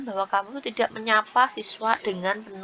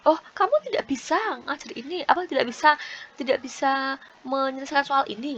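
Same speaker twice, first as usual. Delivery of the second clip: higher-pitched, mock-incredulous belittling; belittling teacher; 4 semitones higher than usual. Shown as a demonstration. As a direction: talk down to a struggling pupil in a raised voice. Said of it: "terkesan membuli ya".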